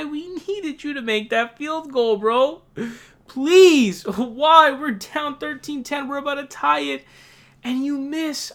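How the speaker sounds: real anguished fan, high and pleading; anguished wail. Real, not acted. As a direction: wail at him like he broke your heart. Fan wailing at missed field goal.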